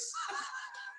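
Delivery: high cackling laugh. Real, not acted. Man laughing high at his own story. Laughs after sergeant line.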